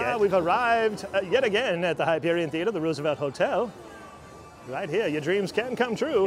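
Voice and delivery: put-on old-timey voice. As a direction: announce it like a vintage radio host. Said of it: "break out into, into our old-timey voices".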